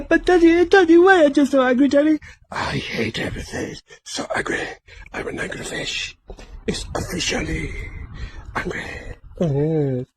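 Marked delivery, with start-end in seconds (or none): 0.0-2.2 whiny son voice. Pretend. Son fish voice again. 2.5-8.8 whisper. Pretend. Whispered angry fish voice.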